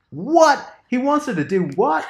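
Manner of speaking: high, loud, incredulous what; shocked disbelief. Retold or imitated. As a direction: recreate your jaw-dropping reaction, laughing at the end. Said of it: "And I was like".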